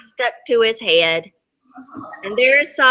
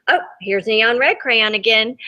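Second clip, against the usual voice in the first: ordinary delivery, nothing marked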